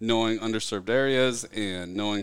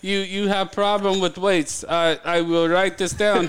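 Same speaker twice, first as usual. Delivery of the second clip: higher, slow put-on Italian accent with laughter; a joking Italian receptionist taking notes; a hammed-up Italian accent breaking into laughs; mock Italian accent; 6 semitones higher than usual. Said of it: "completely an Italian accent".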